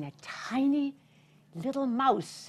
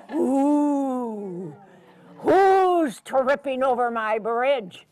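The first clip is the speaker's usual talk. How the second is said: loud, slow troll challenge; troll under the bridge calls out; 8 dB louder, much slower than usual. Acted. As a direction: boom it slowly like a troll. Troll voice in story.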